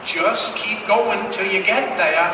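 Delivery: put-on slow, drawn-out Maine accent; the old mechanic's slow Maine accent advice. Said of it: "in that same Maine accent".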